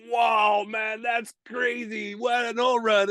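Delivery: drawn-out, mock-shouted awe; amazed cheering. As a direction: imitate a group of guys wowed, stretching the words. Retold amazed agents watching video.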